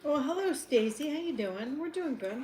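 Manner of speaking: hello sung out high and cheerful; bright greeting. Real, not acted. Host greeting caller Stacy, cheerful and high. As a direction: welcome the caller with a lifted cheery voice.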